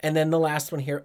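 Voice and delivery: usual speaking voice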